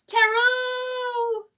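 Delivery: a high, long call of a name; crying out. Acted. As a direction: cry his name into the dark. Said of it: "all she can cry is".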